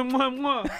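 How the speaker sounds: loud smacking kisses; between kisses. Acted. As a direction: blow three loud kisses. Kissing sounds while tucking hair.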